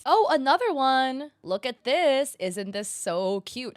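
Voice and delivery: fake-thrilled at another stolen design; mock excitement. Sarcastic excitement at next shirt.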